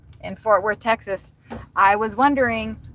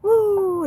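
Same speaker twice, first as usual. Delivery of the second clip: a sharp, much higher whoop; excited woo; 13 semitones higher, 3 dB louder than usual. Real, not acted. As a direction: whoop of nervous relief. Excited shout after passing agents.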